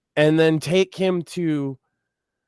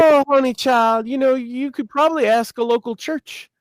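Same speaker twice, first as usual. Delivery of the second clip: third lady's pitying voice; 8 semitones higher than usual. Retold or imitated. Hotline lady pointing caller to church, high pitying voice. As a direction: imitate a kindly hotline worker gently giving up. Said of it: "And she's just like".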